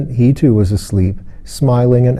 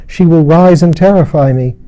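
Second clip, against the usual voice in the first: higher, louder frightened voice; afraid; 6 semitones higher, 4 dB louder than usual. A narrator's reading. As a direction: voice the dread rising. Lilith afraid of Lona.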